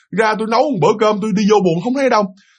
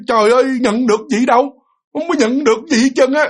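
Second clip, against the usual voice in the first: higher-pitched retold complaint, on equal footing; mildly acted cheeky back-talk, pitched up; 6 semitones higher than usual. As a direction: imitate a child answering an elder as an equal. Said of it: "Nó nói kiểu như là ngang hàng, ngang vế".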